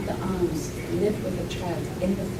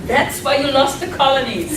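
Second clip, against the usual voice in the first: laughing teasing jab, a bit louder; 7 semitones higher, 7 dB louder than usual. Retold or imitated. Mother teasing her English family about the colonies, laughing. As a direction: needle them with a grin. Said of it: "I sometimes say to them".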